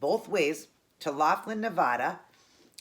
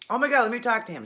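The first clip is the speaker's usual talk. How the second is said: raised, louder voice of an angry mom; retold outraged mom; mad; 6 semitones higher, 5 dB louder than usual. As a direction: demand it, pitched up in indignation. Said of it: "She was so mad".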